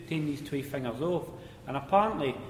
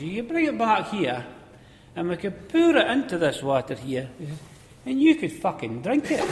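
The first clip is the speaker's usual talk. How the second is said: higher and louder, building to the swear; old miner taunting the manager; cheeky punchline; 5 semitones higher, 7 dB louder than usual. Retold or imitated. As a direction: land the punchline with relish.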